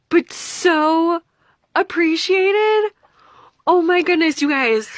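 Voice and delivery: high, emphatic, overwhelmed thanks; gushing. Real, not acted. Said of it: "I know, I'm gushing".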